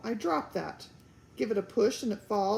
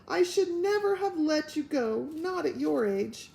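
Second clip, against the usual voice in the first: wailing; 6 semitones higher, 3 dB louder than usual. Acted. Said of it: "wailed Homily".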